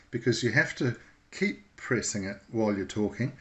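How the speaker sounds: normal talking voice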